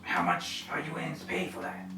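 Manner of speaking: soft breathy bargaining; a quiet, scheming thief; hushed sly thief. Acted. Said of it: "thief voiced by GM".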